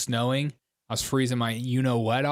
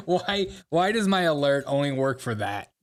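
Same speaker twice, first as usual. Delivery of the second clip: usual speaking voice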